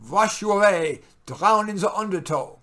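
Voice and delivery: ordinary talk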